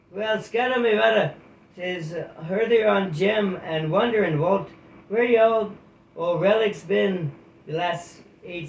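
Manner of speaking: put-on high, loud salty old otter; hearty otter sailor. Skipper greets old friends at the gate, loud and jolly. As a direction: bellow a jolly sailor's welcome.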